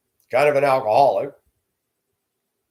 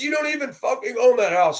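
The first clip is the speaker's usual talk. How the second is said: much higher, snarling put-on voice; angry neighbor; 13 semitones higher than usual. Neighbor sneering that he doesn't own the house, high angry voice. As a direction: spit it out as the hostile neighbor.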